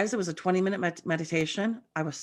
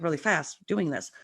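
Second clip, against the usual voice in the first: normal talking voice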